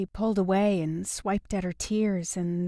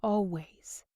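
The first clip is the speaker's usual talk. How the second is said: put-on whispered softly over a sleeping baby; hushed whisper; 7 dB quieter, slower than usual. Mother whispering to the baby as she lays her down, hushed whisper. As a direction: breathe the words, barely voiced.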